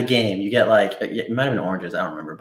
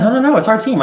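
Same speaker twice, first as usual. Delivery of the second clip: high, loud quick no's; urgent protest; 12 semitones higher, 6 dB louder than usual. Real, not acted. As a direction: object fast before they quit. Protesting quitting the team.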